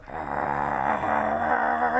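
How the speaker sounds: mock lion growl; low rumbling lion growl. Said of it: "that lion in there is going".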